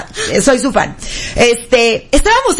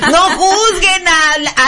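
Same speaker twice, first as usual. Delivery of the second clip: ordinary talk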